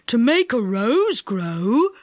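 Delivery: put-on slow old man mishearing "rose" with a rising tune; delighted. A narrator's reading. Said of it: "said Saucepan in delight".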